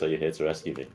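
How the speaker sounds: level, unexcited creature voice; monotone. Acted. Salamander asking if it is being rescued, monotone. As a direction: keep every word on one note. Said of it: "it says in a monotone voice".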